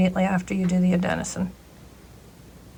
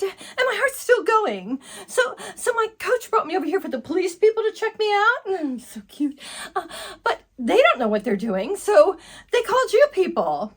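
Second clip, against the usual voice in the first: put-on high giggly valley girl rattling on to the paramedic; ditzy teen girl; 9 semitones higher than usual. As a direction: swoon over the cute boy, then brag about dad. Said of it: "my name's Candy".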